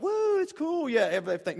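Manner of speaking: high playful whoop of a thrill ride; mock woo. Pastor mimicking a fun ride with kids, whooping. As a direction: cheer like a kid on a coaster.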